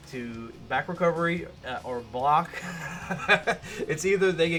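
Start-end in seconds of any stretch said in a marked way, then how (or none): 2.5-3.8 short laugh. Real, not acted. Laughing at opponents' mistakes.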